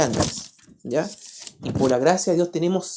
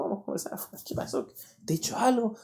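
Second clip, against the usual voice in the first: softer, half-whispered words to one student; hushed private talk; 4 semitones higher, 8 dB quieter, faster than usual. Retold or imitated. A teacher quietly asks an upset student what happened, hushed.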